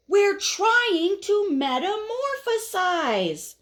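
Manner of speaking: high, slow, stretched-out scolding; annoyed group complaint. Acted. Caterpillars all together scold the impatient one, annoyed. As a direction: drag each word out high and exasperated. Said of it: "all together they say".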